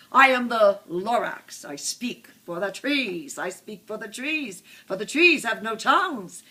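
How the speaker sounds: bossy speech building to a shout at the top of the lungs; sharp, bossy Lorax. A narrator's reading. Lorax speaks for the trees to the Once-ler, bossy, building loud. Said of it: "spoke with a voice that was sharpish and bossy".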